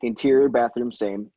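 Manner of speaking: ordinary talk, nothing marked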